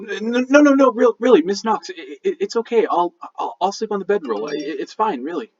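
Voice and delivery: high, stammering protest; flustered rush. Acted. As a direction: rush in anxiously to reassure, tripping over words. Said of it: "Borgub will rush up".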